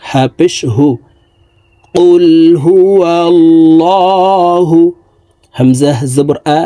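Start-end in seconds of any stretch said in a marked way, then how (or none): 1.9-4.8 কুল হুয়াল্লাহু chanted slowly, higher, with a thick ল; heavy lam recited. Shown as a demonstration. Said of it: "আমরা ল বলে পড়ব". (মোটা ল দিয়ে সুরে কুল হুয়াল্লাহু)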